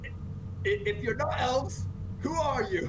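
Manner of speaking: stammering, high-pitched, slow questioning; falter, then demand an answer in a high voice; puzzled demand. Acted. Armored fighter questioning the strangers who aren't elves, puzzled demand.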